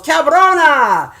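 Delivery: high, drawn-out holler; Ruben. Retold or imitated. Imitating her friend spotting her years later, loud holler. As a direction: imitate a friend yelling a greeting, stretched out. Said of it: "I bet you he goes".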